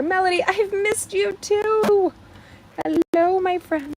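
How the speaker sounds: high, slow, sing-song hello; gushing greeting. Real, not acted. Host greeting a returning live viewer, high and gushing. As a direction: greet a dear friend with delight.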